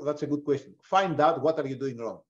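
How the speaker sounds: ordinary delivery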